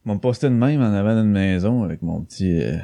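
usual speaking voice

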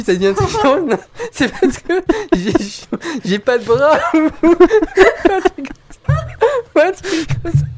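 high-pitched, breaking into laughter; can't stop laughing while telling it. Real, not acted. Telling a gag, cracking up.

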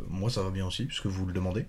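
soft, mock-pitiful self-pity; a hint of hard-done-by Calimero; playing Calimero. Host pretends nobody asked about him, in a soft mock-pitiful voice. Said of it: "je me la joue Calimero un peu".

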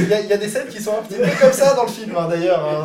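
laughing reply to a cheeky joke. Real, not acted. Laughing at zizi remark.

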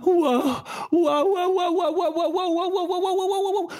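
high, laughing waouh chant; drugged wonder. Acted.

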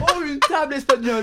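high, slow mock wonder; mock excitement. Friend mocking the Spanish cat story, high slow mock wonder. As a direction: gush over a table to tease.

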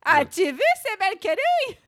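high, very loud comic yell; mic-saturating shout. Acted. Host overdoing a catchphrase for friends, mic-saturating shout. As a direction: holler until the mic distorts. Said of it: "Pardon pour la saturation".

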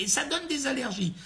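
high, mocking imitation of a crank; squeaky voice to make fun of her. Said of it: "avec une voix de Cresset".

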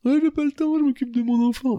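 high-pitched teasing impression of a co-host; mock co-host. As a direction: parody the busy dad brushing you off. Mocking co-host's excuse.

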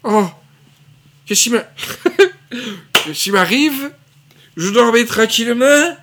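plus aigu et plus fort, réveil hébété; Galabru au paradis. Acted. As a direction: jouer un vieil homme qui se réveille perdu. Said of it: "Je voulais faire Galabru qui était accueilli par De Funès". (Galabru perdu au paradis)

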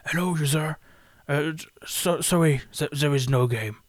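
a light take on a hesitant English narrator; game narrator voice. Retold or imitated. Imitating the game's English narrator greeting the user. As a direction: stammer like an awkward narrator addressing the player. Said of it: "[name] qui, vous allez voir".

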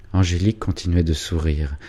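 usual speaking voice

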